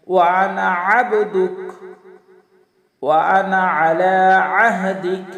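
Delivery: long drawn-out chanted recitation; slow Quranic recitation. Shown as a demonstration. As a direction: intone each word slowly and reverently. Recites dua.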